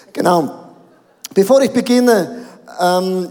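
usual speaking voice

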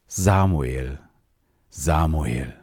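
put-on God calls the sleeping boy by name, slow and solemn; a solemn divine call, unhurried. God calls young Samuel in the night, solemn calling voice. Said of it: "rief wie vorher".

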